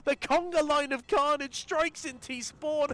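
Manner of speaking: high, loud esports casting; hyped play-by-play. Real, not acted. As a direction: call the action at full hype.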